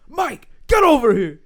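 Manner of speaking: loud, high imitation of a bossy host; shouted summons. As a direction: yell across the bar for an employee. Acting out Taffer summoning an employee.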